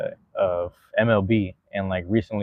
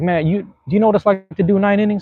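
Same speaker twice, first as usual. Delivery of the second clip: much higher scolding, put-on voice; imitating annoyed player; 10 semitones higher, 3 dB louder than usual. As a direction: lecture the fan like a fed-up pro. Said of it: "He's like".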